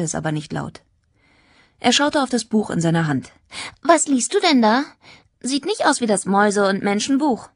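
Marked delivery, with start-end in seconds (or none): 3.8-7.5 put-on raised, lilting girl's voice; curious schoolgirl. Tanja quizzes Jack about his book, curious schoolgirl. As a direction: ask nosily as a lively teenage girl.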